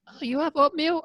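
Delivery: squeaky put-on voice for the doorman; high teasing doorman impression; mock doorman.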